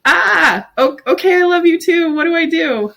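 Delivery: high-pitched mock panic; acting out awkward alarm at affection; flustered. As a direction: blurt it in a high, overwhelmed voice. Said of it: "I couldn't, I couldn't let it in".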